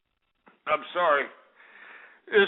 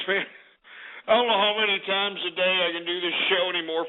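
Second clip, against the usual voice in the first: ordinary talk, nothing marked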